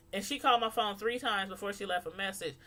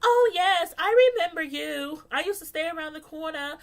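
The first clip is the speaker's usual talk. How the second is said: mock proper voice; 6 semitones higher, 5 dB louder than usual. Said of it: "you're like".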